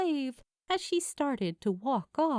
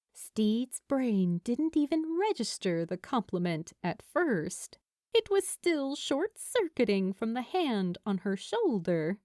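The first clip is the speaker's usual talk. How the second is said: plain reading voice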